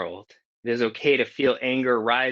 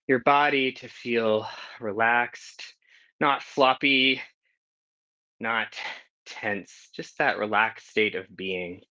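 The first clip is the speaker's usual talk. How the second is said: guided meditation. Acted. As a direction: ease them gently into stillness. Leads a centering meditation.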